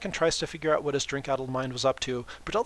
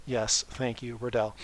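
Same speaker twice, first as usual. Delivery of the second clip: ordinary delivery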